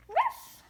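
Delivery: high, loud pretend ruff; imitated puppy bark; dog bark. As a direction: yap a goodbye like a little dog. Plays the dog barking.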